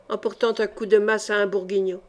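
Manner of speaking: ordinary delivery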